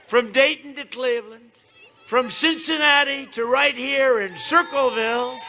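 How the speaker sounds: booming campaign rally delivery; rally speech. Acted. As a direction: shout it to a packed arena.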